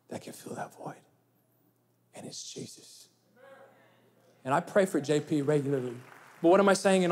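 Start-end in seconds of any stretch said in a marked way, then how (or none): 0.1-3.1 whispered. Retold or imitated. Pastor quotes his own answer.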